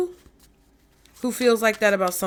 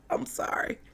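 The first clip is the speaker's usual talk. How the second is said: whispered; 10 dB quieter than usual. Real, not acted. Laughing apology after joke.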